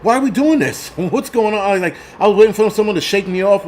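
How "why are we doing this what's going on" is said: much higher, baffled questions with a laugh; as if stuck in a strange dream; retold bewilderment. Biker recalling the scooter pack, baffled and much higher. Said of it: "I'm like".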